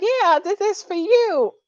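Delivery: put-on high, loud girlish coo; little girl voice. Shown as a demonstration. Telling Jasmine how to greet him, high little girl coo. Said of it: "in your little girl voice".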